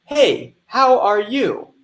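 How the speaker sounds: loud, high model phrase for students to repeat; shadowing example. Shown as a demonstration. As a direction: say it bright and clear so they can echo it. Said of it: "te voy a dar un ejemplo".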